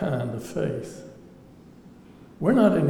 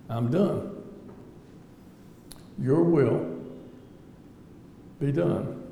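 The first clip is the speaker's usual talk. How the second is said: real emotion, quieter and halting; getting emotional; 4 dB quieter, slower than usual. Real, not acted. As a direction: hold back feeling, leaving long pauses. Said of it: "getting a little bit emotional".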